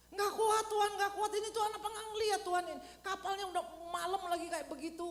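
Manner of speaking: loud, high, fast complaining cry to God; desperate complaint. Acted. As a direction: wail that God isn't watching. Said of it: "kurang berdoa". (jemaat mengeluh kepada Tuhan, teriakan tinggi dan cepat)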